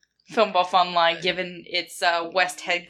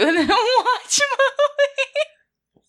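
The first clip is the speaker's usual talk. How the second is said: laughing; 4 dB louder, much slower than usual. Real, not acted. Teasing co-host about sending everyone to the movies, laughing. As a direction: laugh through the words, pitch climbing high.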